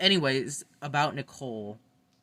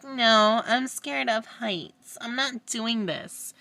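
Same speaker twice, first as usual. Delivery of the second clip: high, slow, whiny mock voice; mocking Jasmine; 9 semitones higher, 4 dB louder, slower than usual. Vlogger mocking Jasmine at the veto comp, high whiny voice. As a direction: mimic her fearful refusal mockingly. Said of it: "she's like".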